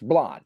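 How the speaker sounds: "blod" with an ah vowel for blood; firm, open-vowelled "blod"; their own pronunciation. Shown as a demonstration. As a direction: say "blod" rhyming with nod.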